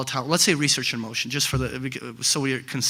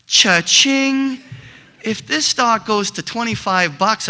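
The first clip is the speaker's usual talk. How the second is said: loud, much higher burst of imitated glee; excited cha-ching; 6 semitones higher, 5 dB louder, slower than usual. Investor hears the analyst's target and shouts with glee. Investor dreams of a big profit.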